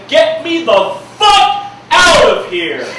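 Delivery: high, loud, slow yell of panic with a laugh; half-laughing shout to escape the overwhelming room; panicked shout. Acted. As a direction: blurt it like you need out now. Quoted panic inside the poem.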